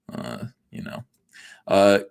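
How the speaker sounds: normal talking voice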